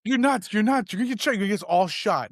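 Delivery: put-on high-pitched panicked kids' protest; imitated freak-out. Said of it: "They freak out".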